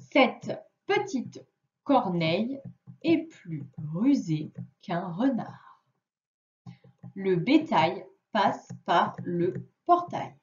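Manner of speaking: put-on slow, careful reading for a child; slow read-aloud. Shown as a demonstration. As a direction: read each word slowly for a young pupil. Reads the sentences aloud. (lecture lente et posée pour un enfant)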